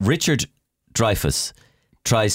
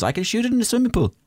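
mock director's quote; faster than usual. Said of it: "he goes".

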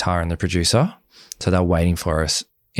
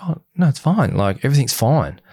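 high-pitched mimicked brush-off; breezy denial; 7 semitones higher than usual. Partner dodging why she cried, mimicked breezy denial. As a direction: insist lightly in a raised, imitated voice.

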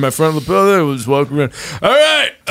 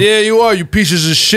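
Johnny Brooklyn; 4 semitones higher than usual. Acted. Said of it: "It's Johnny Brooklyn".

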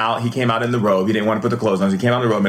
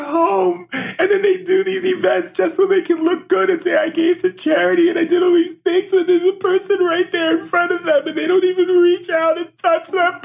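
real tearful plea, voice pitched very high; high, tearful outpouring; crying. Real, not acted. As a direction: plead through sobs, voice climbing high. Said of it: "I'm gonna start crying".

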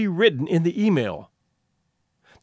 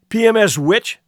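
louder, sneering jab; angry insult; 4 semitones higher, 5 dB louder than usual. Acted. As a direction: fling the insult back. Insult exchange between students.

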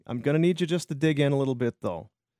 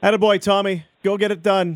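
cheering; 5 semitones higher, 5 dB louder than usual. Real, not acted. Cheering on departing guest.

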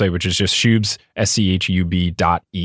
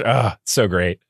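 ordinary delivery

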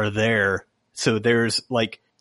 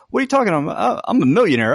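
much higher, offended protest; indignant Wesley; 9 semitones higher, 3 dB louder, much faster than usual. Retold or imitated. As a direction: act insulted by the suggestion. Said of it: "He's like".

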